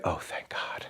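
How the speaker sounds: breathy whispered relief, almost soundless; whispered relief under the breath; relieved whisper. Retold or imitated. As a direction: let it out as a hushed thank-God whisper. Relief as the doctor ends pumping.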